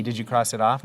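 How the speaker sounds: usual speaking voice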